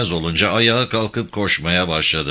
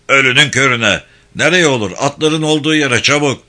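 loud, higher, exasperated command; irritated order; 4 semitones higher, 5 dB louder than usual. Acted. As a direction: snap back at a foolish question. Officer snaps back at soldier's question. (sinirli, yüksek sesle emir)

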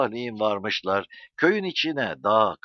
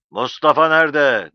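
loud, raised-pitch roar; roaring demand; 5 semitones higher, 11 dB louder than usual. A narrator's reading. İsmail Ağa to silent Zero once his smile freezes, roaring. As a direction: roar the question, demanding an answer. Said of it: "diye kükrer gibi konuştu".

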